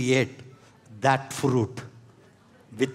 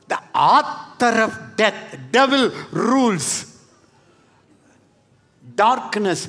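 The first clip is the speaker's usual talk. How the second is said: preaching at full force; 4 semitones higher, slower than usual. Real, not acted. Climactic preaching shout.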